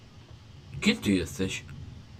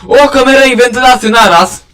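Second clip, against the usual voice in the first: frantic loud panic; 5 semitones higher, 11 dB louder than usual. Real, not acted. Vent broken, monster coming.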